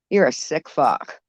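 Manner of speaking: normal talking voice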